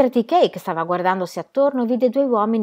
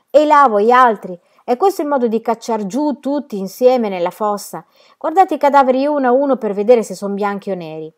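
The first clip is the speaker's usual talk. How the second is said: loud, higher-pitched scolding orders; shouted reprimand; 5 semitones higher, 8 dB louder than usual. Acted. Guard stopping men filling the grave, shouted reprimand. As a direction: call out sharply to a work gang.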